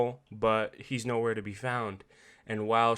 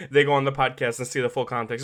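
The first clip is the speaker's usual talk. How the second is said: ordinary talk, nothing marked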